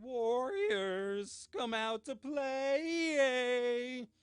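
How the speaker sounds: loud, high-pitched taunting call, stretched out; bad Warriors impression. Host doing a bad movie impression for viewers, hammed-up high yell. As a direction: sing it out mockingly, dragging the last word way out. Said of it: "I'm gonna do a bad impression".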